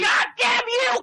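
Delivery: strangled, slow furious cry; furious yell. Acted. Little man lunging at the big kid, screaming in fury. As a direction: scream it with everything you have. Fight climax.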